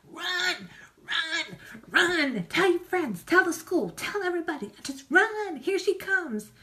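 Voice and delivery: little fish screaming warnings to the school; panicked little fish. Pretend. Fish fleeing from her, voiced.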